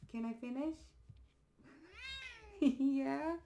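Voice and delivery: high, soft, laughing coo. Real, not acted. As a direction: tease the pet playfully. Talking to her pet.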